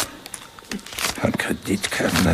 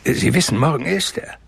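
ordinary delivery

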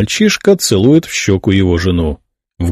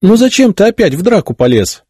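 put-on a high, fussing hen-like coo over her boy; clucking; 12 semitones higher than usual. A narrator's reading. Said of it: "закудахтала Людмила". (высоко, с квохтаньем, как наседка)

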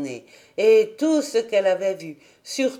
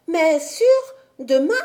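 put-on anxious child; 8 semitones higher, 5 dB louder than usual. A narrator's reading.

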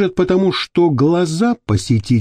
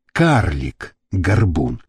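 ordinary delivery, nothing marked

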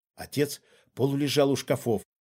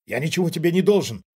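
quick, louder, higher rebuttal; sharp retort; 4 semitones higher, 5 dB louder, faster than usual. A narrator's reading. Father denies a debt to the intruder, sharp retort. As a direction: throw it back sharply and fast. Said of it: "резко бросил отец".

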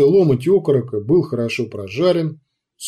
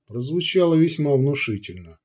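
ordinary delivery, nothing marked

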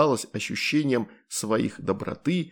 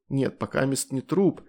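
ordinary delivery

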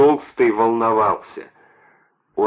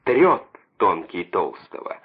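usual speaking voice

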